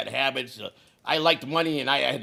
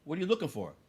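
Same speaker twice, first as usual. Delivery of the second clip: cool and calm; 8 dB quieter, faster than usual. Retold or imitated. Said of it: "she comes in there really cool".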